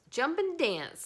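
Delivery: high, loud rooster voice. A narrator's reading. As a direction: crow it like a rooster. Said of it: "said the rooster".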